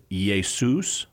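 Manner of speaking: "Jesus" said Greek-style as yay-SOOS; Greek pronunciation. Shown as a demonstration. Apologist tracing the name into Greek, Greek pronunciation. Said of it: "got passed into Greek as".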